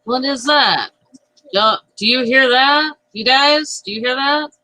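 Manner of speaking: Longus. Acted. Player as Longus asking the others about a grinding sound, lightly put-on character voice. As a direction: pitch it up, asking the party in alarm. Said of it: "That's me trying to be Longus".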